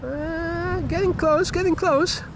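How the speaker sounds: high, tense and excited; rising nervous excitement. Real, not acted. Plane nears the tower.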